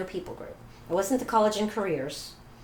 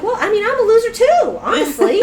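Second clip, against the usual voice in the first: high, loud blurt of loser; self-mocking outburst; 16 dB louder than usual. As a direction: throw it out exasperated. Retold outburst continues.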